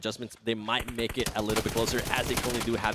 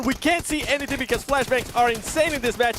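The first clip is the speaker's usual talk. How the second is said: excited play-by-play; 12 semitones higher, 5 dB louder than usual. Real, not acted.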